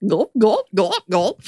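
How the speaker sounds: playful gulp noises; mock gulping sounds. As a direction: say gulp like swallowing. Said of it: "mimicking drinking sounds".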